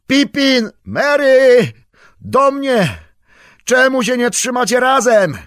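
loud, high anxious shouting; calling out. Acted. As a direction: call them back, scared and scolding. Calling lost friends in fog.

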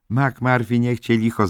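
wysokie, głośne zawołanie dziewczyny; zawołaj podniesionym głosem; wołanie. A narrator's reading. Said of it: "zawołała Candy". (głośny, wyższy okrzyk powitania)

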